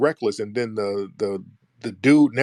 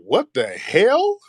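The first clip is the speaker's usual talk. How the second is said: high, slow, drawn-out disbelief; stunned what the hell; 9 semitones higher, 4 dB louder, much slower than usual. Retold or imitated. Teller reacts to the heckler calling his name, stunned and drawn out. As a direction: let the words stretch out in shock.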